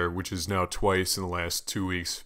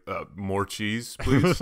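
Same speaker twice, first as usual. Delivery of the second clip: slow, timid ask for more; polite request; much slower than usual. Retold or imitated. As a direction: ask sweetly, holding back a laugh. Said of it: "I almost laughed".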